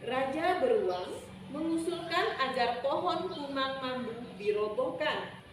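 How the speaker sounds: ordinary delivery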